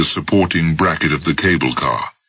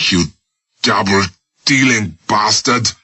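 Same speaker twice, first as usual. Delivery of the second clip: angry curse; 4 semitones higher than usual. Acted. Captive spits at his betrayer in anger. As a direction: curse the traitor with contempt.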